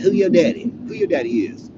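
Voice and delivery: high, loud, pushy question in a guard's voice; hammed-up guard demanding to know who his daddy is; retold local guard. Guard quizzing the new inmate, loud and high. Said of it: "they say daddy".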